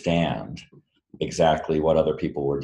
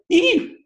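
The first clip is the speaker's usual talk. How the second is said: startled high-pitched squeak; sudden tiny squeal, very high and loud; 4 dB louder than usual. Retold or imitated. Author imitating his character's shock at a naked friend, squeak. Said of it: "sort of squeaks".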